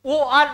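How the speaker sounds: ও আন with ও kept apart from আন; ও আন deliberately separated; wrong split pronunciation. Shown as a demonstration. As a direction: say ও আন as two unblended vowels, "o, an". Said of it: "উচ্চারণ করি না".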